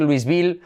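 LOUISVILLE as "luis-BIL", with S and stressed ending; wrong pronunciation. Shown as a demonstration. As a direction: mispronounce LOUISVILLE with the S sounded. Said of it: "ya, para decir".

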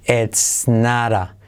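"it's not a" drawn out slowly as "ets nara"; T as soft R. Shown as a demonstration. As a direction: stretch "not a" into "naara" with tapped R. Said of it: "se convierte en R suave". ("not a" como "nara", muy lento)

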